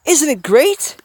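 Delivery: high, loud delighted question; excited. A narrator's reading. Woman showing Hiro her result, excited. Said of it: "the woman declared excitedly".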